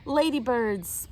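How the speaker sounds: plain reading voice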